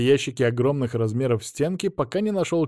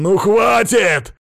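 high, loud, drawn-out bellow; yelling; 8 semitones higher, 9 dB louder, much slower than usual. A narrator's reading. Millionaire interrupting the officer, yelling. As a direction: roar to cut him off. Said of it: "заорал Мамонов".

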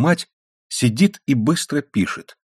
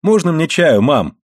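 put-on higher-pitched boyish call; 6 semitones higher, 3 dB louder than usual. A narrator's reading. Son calling to mum from the doorway, calling out. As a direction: call in eagerly on arrival. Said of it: "крикнул с порога".

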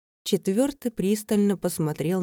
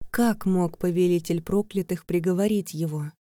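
usual speaking voice

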